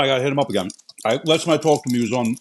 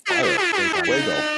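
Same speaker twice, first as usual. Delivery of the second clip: silly high voice; much slower than usual. Pretend. High silly sing-song voice.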